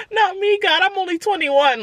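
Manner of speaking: high pleading to God; mock despair. Praying to God mid-surgery, high mock despair. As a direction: beg the heavens in mock despair. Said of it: "I was just like".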